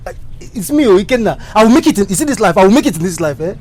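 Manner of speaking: loud, high pleading shout; desperate insistence. Acted. Rejected suitor vows to make it in life, desperate insistence.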